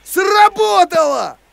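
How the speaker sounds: high, slow triumphant shout; excited cry. Acted. Cat seeing the trick work, high triumphant shout. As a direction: shout it out, it worked!. Hypnosis reversal works.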